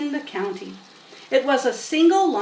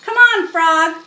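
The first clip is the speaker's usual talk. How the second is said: loud, slow, higher call to the frog; crying out; 6 semitones higher, 9 dB louder than usual. A narrator's reading. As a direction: call the frog loudly, drawn out. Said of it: "cried Avery".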